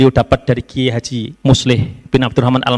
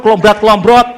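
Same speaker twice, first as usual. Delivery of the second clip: words half laughed out, louder; laughing; 5 semitones higher, 7 dB louder than usual. Real, not acted. Speaker mocking his idle mornings in a sarong, laughing.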